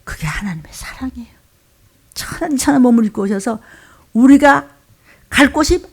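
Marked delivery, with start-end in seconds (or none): 0.0-1.3 very soft, whispered reverence; hushed whisper. Real, not acted. Preacher tells the congregation of God's love, hushed whisper. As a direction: let the voice fall away to a whisper.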